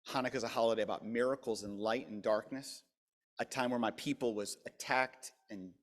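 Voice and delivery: normal talking voice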